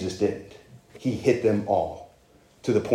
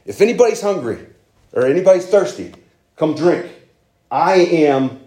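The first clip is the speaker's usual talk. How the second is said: high, loud proclamation, imitating a call to a crowd; calling out publicly; 7 semitones higher, 7 dB louder than usual. Preacher as Jesus standing up at a festival, calling out loudly. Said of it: "stood up at a festival and said".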